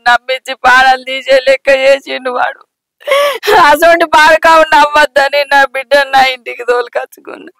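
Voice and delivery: crying mother's bitter keening; wailing curses. Acted. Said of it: "ఏడవకు అత్తమ్మ".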